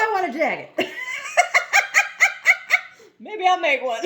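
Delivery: high, giggling through the words; laughing. Real, not acted. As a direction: laugh as you wish for the jacket. Laughs about wanting jacket.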